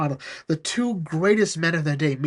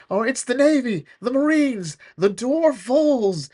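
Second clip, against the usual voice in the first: mock alarm; 8 semitones higher than usual. Scrooge listing his attackers.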